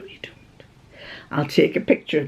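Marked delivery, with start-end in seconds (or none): 0.0-0.6 whispered teasing aside. Real, not acted. Woman whispering a teasing aside to her viewers. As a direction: hush it, a playful secret.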